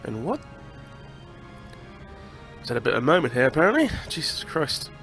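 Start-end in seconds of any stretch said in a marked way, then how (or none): none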